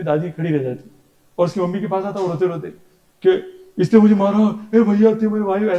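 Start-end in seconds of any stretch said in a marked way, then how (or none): 3.8-5.8 louder, high mock wail of a hit kid; crying child complaint. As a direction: whine like a hurt child to his mother. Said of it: "ऐसे करके". (रोते बच्चे की नकल)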